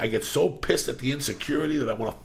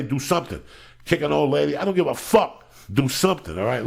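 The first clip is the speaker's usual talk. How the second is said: louder, higher rally-the-fans call; hype shout-outs; 3 semitones higher, 4 dB louder than usual. Acted. Host shouting out fans by city, loud rallying hype. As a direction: rouse the Death Squad like a coach.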